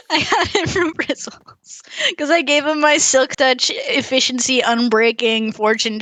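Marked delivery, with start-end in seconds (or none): 0.0-2.2 says it through a laugh; giggling, slow and high. Real, not acted. Repeats the line, laughing.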